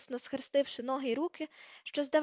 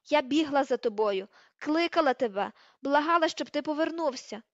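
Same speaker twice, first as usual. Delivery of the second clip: loud, angry reproach; wounded, raised-voice blame; hurt accusation; 3 semitones higher, 7 dB louder than usual. Acted. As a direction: hurl each reproach at him loudly. Hermione accusing Ron of leaving.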